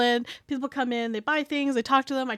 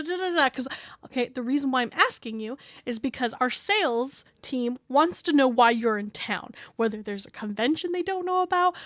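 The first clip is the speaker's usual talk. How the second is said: ordinary talk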